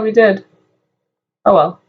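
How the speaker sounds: usual speaking voice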